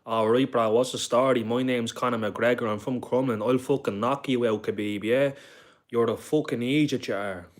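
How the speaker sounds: imitating a brash Irish fighter trash-talking; Conor McGregor impression. Streamer imitating Conor McGregor for chat, loud and brash. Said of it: "This is how Conor McGregor talked".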